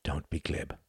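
quiet, earnest plea not to joke; softly. A narrator's reading. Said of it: "she said softly".

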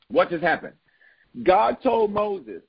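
normal talking voice

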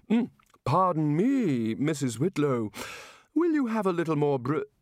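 fuddled don after a belch; Chair of Indefinite Studies voice. Acted. Chair belches, addresses Whitlow.

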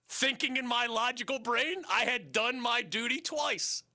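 ordinary talk

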